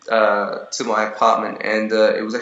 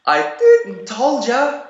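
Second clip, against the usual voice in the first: high, slow, laughing gloat to her friend; imitating a fan; 13 semitones higher, 3 dB louder, much slower than usual. Girl tells her friend she was right, high slow laughing voice. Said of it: "And she's like".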